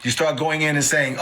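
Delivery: ordinary delivery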